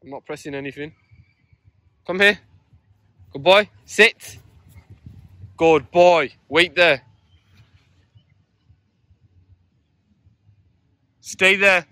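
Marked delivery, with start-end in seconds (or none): 2.1-11.9 loud, high commands and praise; firm dog commands. Real, not acted. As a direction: call him in firmly, then praise.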